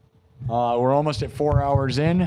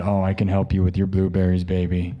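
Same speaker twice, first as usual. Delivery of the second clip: mock seductive woman; 7 semitones lower than usual. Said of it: "And she said".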